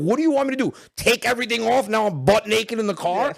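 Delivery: high, loud mock indignation; exasperated comeback. Husband defending himself to his wife, loud comic exasperation. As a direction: protest defensively at the top of your range.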